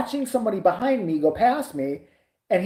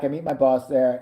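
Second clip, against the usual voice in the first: ordinary delivery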